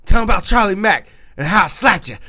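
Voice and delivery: high, loud insistent demand; imitating a drunk friend badgering his girlfriend; mock loud urging. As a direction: push her to tell it, loud.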